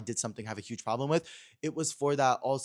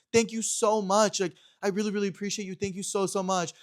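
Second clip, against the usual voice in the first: gushing thanks; 8 semitones higher than usual. Retold or imitated. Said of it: "I'm like".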